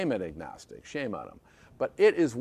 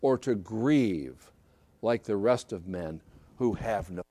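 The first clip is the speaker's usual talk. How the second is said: lower, measured Bible reading; solemn scripture reading; 7 semitones lower than usual. Acted. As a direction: read the holy text with gravity. Reads the scripture passage aloud.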